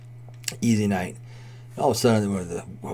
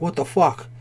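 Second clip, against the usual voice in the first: imitated panicky waitress, higher pitched; mock freak-out in a raised pitch; 6 semitones higher than usual. Waitress overwhelmed by the crowd, freaking out. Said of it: "like, freaking out".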